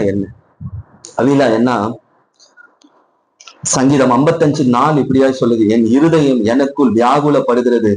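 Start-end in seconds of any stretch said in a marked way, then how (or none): 5.7-7.9 solemn psalm reading. Acted.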